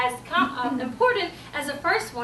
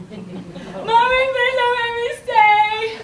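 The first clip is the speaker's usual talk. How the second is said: high, loud sing-song wail; hammed-up little-kid bawling, stretched out; mock child crying; 9 semitones higher, 11 dB louder, much slower than usual. Child begs mommy at the classroom, mock wailing. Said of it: "the crying stops".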